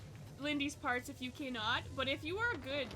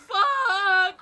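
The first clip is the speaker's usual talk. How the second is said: a loud, laughing curse in flustered delight; overwhelmed laughing yelp; laughing shout; 4 semitones higher, 15 dB louder than usual. Real, not acted. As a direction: shriek it through a laugh. Flustered by a subscription gift.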